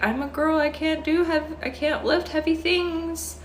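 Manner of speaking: high-pitched put-on girly excuse; mock damsel voice, very high; helpless girl act. Mimics own old helpless-girl excuse.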